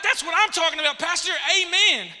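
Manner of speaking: loud, high, hyped-up cheer; excited. Acted. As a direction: fire off an enthusiastic reply. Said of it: "And I'm excited".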